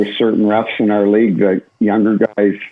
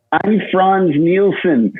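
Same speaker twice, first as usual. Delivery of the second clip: drawn-out, higher-pitched mock accent; Franz Nielsen's accent; 8 semitones higher, much slower than usual. Player answering a chirp from the bench, mock accent. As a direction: imitate a foreign accent, stretched out slowly and high. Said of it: "he had that accent".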